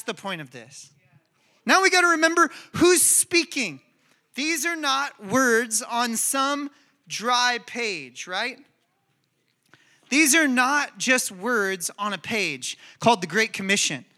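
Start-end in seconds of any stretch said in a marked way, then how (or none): none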